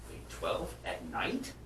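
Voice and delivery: retold disbelief. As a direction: play a mock shocked double take, slowly. Retold disbelief at bartender's answer.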